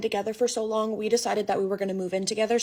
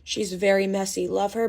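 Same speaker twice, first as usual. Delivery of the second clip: usual speaking voice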